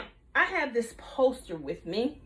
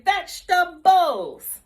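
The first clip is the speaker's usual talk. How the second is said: slow loud call; 4 semitones higher, 7 dB louder, slower than usual. Shown as a demonstration. Teacher leading kids to repeat, slow and loud. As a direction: stretch the word out slowly and loudly. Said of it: "One more time".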